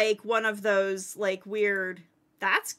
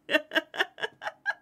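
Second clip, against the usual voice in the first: shocked laughter; 5 semitones higher, 3 dB quieter than usual. Real, not acted. After the surprise drop.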